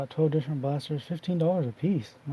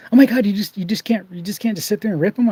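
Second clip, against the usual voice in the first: higher, louder mock disbelief; imitating exasperated coworker; 7 semitones higher, 6 dB louder than usual. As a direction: ham up her shocked "oh my God".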